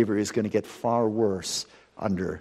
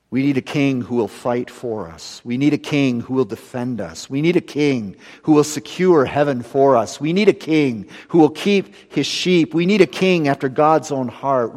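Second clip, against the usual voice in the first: rising, louder repeated we need; building sermon climax; 4 semitones higher, 6 dB louder than usual. Acted. Preacher pleading with the congregation for a better king, building. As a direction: build each line higher to the peak.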